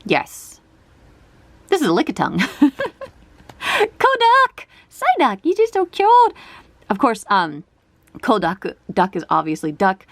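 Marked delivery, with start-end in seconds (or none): 2.6-6.3 high-pitched gushing squeal; excited cute squeal. Real, not acted. Card collector spots a cute Psyduck, squealing. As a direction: coo excitedly, voice shooting up.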